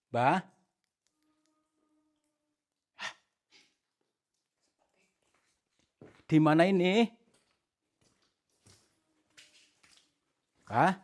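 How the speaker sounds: calling out. Real, not acted. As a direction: shout to locate the voice. Calling out, hearing the voice move. (berseru mencari sumber suara)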